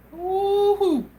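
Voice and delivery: high woo-hoo. Real, not acted. Excited reaction to chat.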